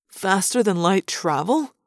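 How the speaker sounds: slow, wondering question; marveling. A narrator's reading. Chapman watches the ship vanish, marveling. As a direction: draw it out in mild amazement. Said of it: "Chapman marveled".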